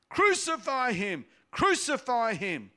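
high, loud chant; shouting crowd. A narrator's reading. Priests and guards shouting at Pilate, loud and high. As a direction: hurl it at the governor. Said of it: "began shouting".

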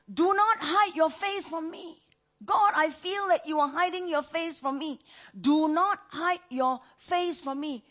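pleading prayer. Acted. Preacher voicing the psalmist's plea.

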